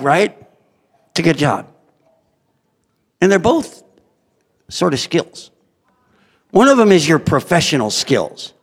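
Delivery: usual speaking voice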